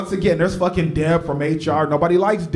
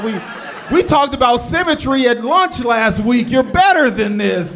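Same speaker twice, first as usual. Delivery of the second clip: higher, louder scolding with a shout on symmetry; a disappointed father tearing into his son; scolding dad; 6 semitones higher, 3 dB louder than usual. Retold or imitated. Dad confronting son over the design, loud scolding. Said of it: "And the dad's like".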